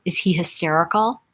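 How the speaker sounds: usual speaking voice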